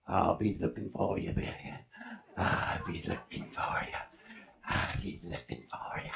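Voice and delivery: very low, hushed monster threat; haunting murmur fading into the dark; distant menacing bushman. Acted.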